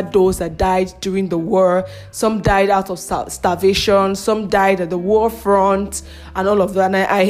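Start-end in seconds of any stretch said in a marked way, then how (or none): none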